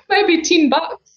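high, slow, words through laughter; laughing. Real, not acted. Guest answering the host's accent tease, laughing. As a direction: barely get it out for laughing.

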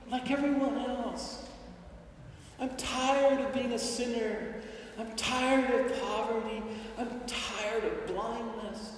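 a quiet begging prayer; hushed pleading beggar. Acted. Blind man softly begging Jesus to open his eyes.

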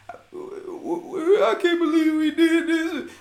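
imitating a preacher's fake wail, loud and high; mock fake crying. Preacher on stage fake crying to his congregation. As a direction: ham up a fake sob. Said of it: "fake cry".

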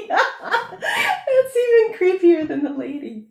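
real laughter breaking up the words; laughing hard. Real, not acted.

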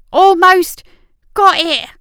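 loud triumphant strain; straining shout. A narrator's reading. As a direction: shout through the effort. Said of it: "urging them on".

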